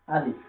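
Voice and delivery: আলিফ said slowly with a clear final f; আলিফ, ending firmly on ফ; আলিফ with the f sound sounded at the end; Arabic letter name. Shown as a demonstration. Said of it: "এখানে ফ দিয়ে উচ্চারণ করতে হবে".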